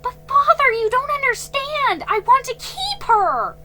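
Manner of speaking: put-on high, loud girlish protest; upset girl crying out to her father. A narrator's reading. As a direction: plead loudly, near tears. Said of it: "cried Felicity".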